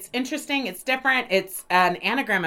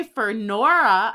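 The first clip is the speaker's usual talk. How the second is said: normal talking voice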